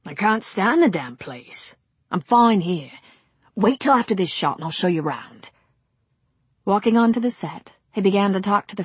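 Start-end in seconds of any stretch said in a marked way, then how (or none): 0.1-5.5 eager film director with a laugh; pushy producer charm; brash director. Acted. Brady speaks.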